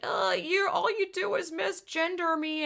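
high, whiny mocking teen voice; a high, put-on whine mocking a teenager's complaint. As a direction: pitch it up and whine like a sulky teen. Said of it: "she was like".

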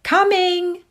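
high, loud, sustained call; calling back. A narrator's reading. Laura answering the call from the house, calling back. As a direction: sing out the answer across the lawn.

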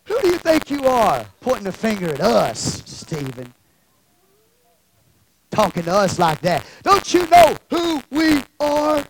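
offended leaders, climbing to a loud finish; indignant challenge. Acted. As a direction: act affronted, stretching the last question. Acts offended religious leaders confronting Stephen.

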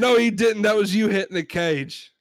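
high, indignant British customer; hammed-up British complainer; British accent. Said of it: "We're gonna try our British accents".

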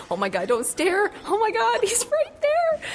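rising to a high, stretched squeal; mock freak-out, building in pitch; starstruck inner panic. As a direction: play a fan trying not to stare. Acts out panicked thoughts on seeing star.

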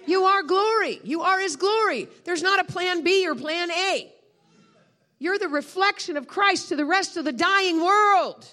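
high, loud, ringing proclamation; emphatic preaching. Real, not acted. Preacher tells congregation who they are, emphatic preaching. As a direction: drive each phrase home at full force.

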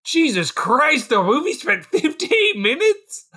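high, slow, incredulous gasp; Jesus Christ, drawn out in disbelief; retelling own shocked reaction, high and laughing. Retold or imitated. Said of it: "I was like".

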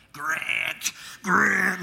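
strained little cartoon-character call; Mickey Mouse voice. Messing with little brother from under the bed, Mickey Mouse voice. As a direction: call his name through cupped hands, cartoonishly. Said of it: "a little high Mickey Mouse voice".

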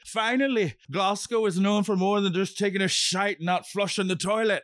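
bad Scottish accent. Quoting a drag contestant from Scotland, bad Scottish accent. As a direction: put on a clumsy Scottish accent. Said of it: "inability to do a Scottish accent".

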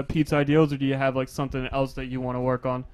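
ordinary talk

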